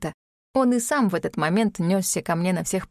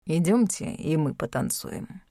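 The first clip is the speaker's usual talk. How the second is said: low, slow words forced through teeth; through gritted teeth; 5 semitones lower, 4 dB quieter than usual. Acted. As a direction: speak through clenched teeth. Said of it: "сквозь зубы".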